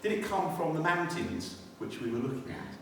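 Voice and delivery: ordinary delivery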